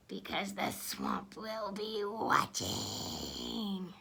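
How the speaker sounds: slow, soft menace; quiet looming threat; menacing warning. Acted. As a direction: warn them slowly and ominously. Coach Swamp's threat to the team.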